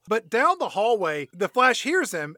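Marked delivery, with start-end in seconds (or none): none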